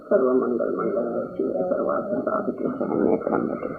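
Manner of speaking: rapid whispered Sanskrit prayer under the breath; whispered mantra. Real, not acted. Reciting a prayer for the families, fast and whispered.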